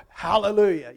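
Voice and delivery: loud, joyful Hallelujah; excited hallelujah. Retold or imitated. Young people cheering the new foundation, loud and joyful. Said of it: "they're excited".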